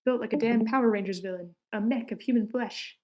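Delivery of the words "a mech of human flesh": normal talking voice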